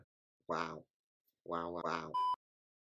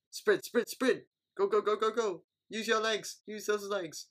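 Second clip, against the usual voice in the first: higher, insistent chant of encouragement; urging cheer; 9 semitones higher than usual. Real, not acted. Fan urging a sprinter on, higher and insistent. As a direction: push the runner home with your voice.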